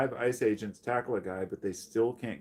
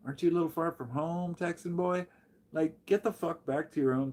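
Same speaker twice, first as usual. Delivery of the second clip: mocking taunt; 7 semitones higher than usual. Real, not acted. Taunting Border Patrol agents.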